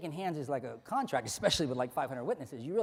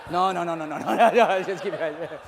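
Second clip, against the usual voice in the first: laughing; 8 dB louder than usual. Real, not acted. Laughing at volunteer's antics.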